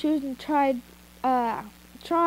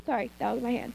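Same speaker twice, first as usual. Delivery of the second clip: plain reading voice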